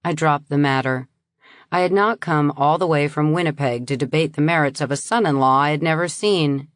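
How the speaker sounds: ordinary delivery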